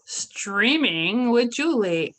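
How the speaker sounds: slow, drawn-out villain voice; slow villain drawl. Acted. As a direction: drag out the title menacingly. Show title slow, far timbre.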